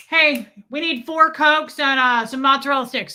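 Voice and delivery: loud, high, slow ordering; rude customer. Acted. Restaurant diner ordering at a server, loudly demanding. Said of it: "watch someone be like".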